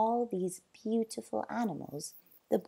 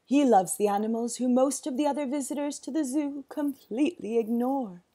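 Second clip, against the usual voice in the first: zookeeper; 5 semitones higher, 6 dB louder than usual. A narrator's reading. Winston continues.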